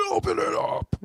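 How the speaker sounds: very high, strained yell at reduced volume; mock door-side yell. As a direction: imitate shouting through a door, held back in volume. Acts out yelling at sister's door.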